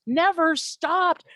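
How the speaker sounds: stopped said slow and high, drawn out with a rise at the end. Real, not acted. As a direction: stretch out Never stopped in exasperation. Repeating complaint, second take stretched.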